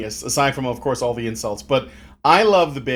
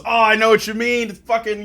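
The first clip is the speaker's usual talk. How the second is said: higher, louder mock agreement; commiserating; 7 semitones higher, 5 dB louder than usual. Foreman agreeing with a car complaint, commiserating. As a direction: jump in with imitated fellow-feeling.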